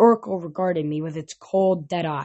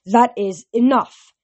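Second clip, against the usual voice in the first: slow, loud firm order; stern command; 5 semitones higher, 6 dB louder, slower than usual. A narrator's reading. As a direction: lay down the law calmly but firmly. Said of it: "Chiron said".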